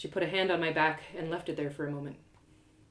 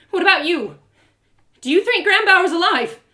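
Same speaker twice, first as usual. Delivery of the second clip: pleading, much louder and higher, close to tears; desperate plea; 11 semitones higher, 13 dB louder than usual. Acted. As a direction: begs for an answer, voice straining. Desperate narrator asks Trenia.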